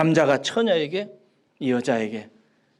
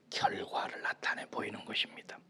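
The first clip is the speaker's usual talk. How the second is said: whisper; 9 semitones lower, 15 dB quieter than usual. Acted. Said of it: "whispered sermon point".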